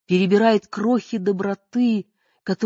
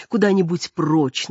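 plain reading voice

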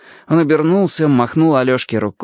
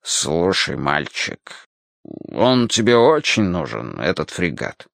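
low, hesitant, softened request; embarrassed old sailor; 5 semitones lower, slower than usual. Acted. Elderly sailor shyly asks a boy about his ship, embarrassed. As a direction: ask awkwardly, voice dropped. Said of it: "смущённо проговорил".

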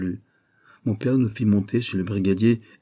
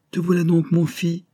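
high, louder glad exclamation; cried out; 9 semitones higher, 3 dB louder than usual. A narrator's reading. Seamstress welcomes the rescued boy, exclaiming. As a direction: greet the boy with joy. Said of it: "s'écria-t-elle".

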